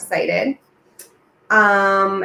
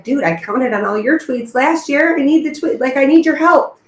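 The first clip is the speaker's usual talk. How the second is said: lightly acted protest, rising higher; mock exasperated plea; 6 semitones higher, 5 dB louder than usual. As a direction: plead it louder and higher as retold. Retells own pleading reply.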